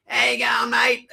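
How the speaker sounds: high, loud mock Ocker greeting; cartoon Aussie shout; broad Aussie accent. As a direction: ham up the TV Australian. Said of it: "on T V when you see an Australian, they're very".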